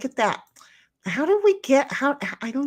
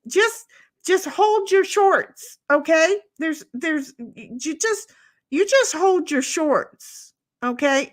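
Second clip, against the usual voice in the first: high, stammering, exasperated telling-off; short-fused; 8 semitones higher, slower than usual. Real, not acted. Telling Bud to hold on, short-fused. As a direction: tell them to wait, patience running out. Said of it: "I'm short fusing over here".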